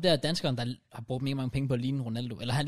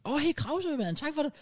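delighted mock reply; 12 semitones higher than usual. Streamer thanking a fan by name, mock delighted. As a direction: imitate a thrilled thank-you in a high voice.

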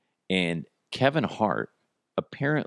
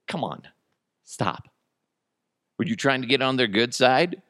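usual speaking voice

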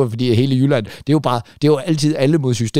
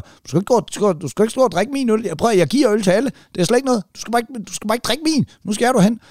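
higher, heated telling-off of a beer thief; retold angry scolding; 7 semitones higher than usual. The teller retells scolding the dwarf backstage, mock heated telling-off. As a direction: scold him like it's your beer. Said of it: "retold bar quarrel, own voice".

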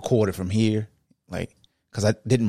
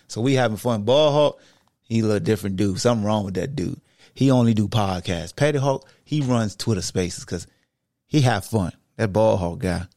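usual speaking voice

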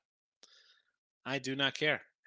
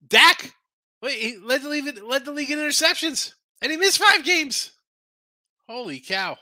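higher and louder, building disbelief; incredulous rant; 10 semitones higher, 6 dB louder than usual. Real, not acted. Host ranting to viewers about Dak's interceptions in disbelief. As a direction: pile it on, more outraged each phrase.

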